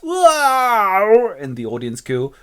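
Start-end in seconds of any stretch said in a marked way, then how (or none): 0.0-1.4 long, loud, high wail; drawn-out mock dying howl; hammy death cry. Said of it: "dying a slow and painful death".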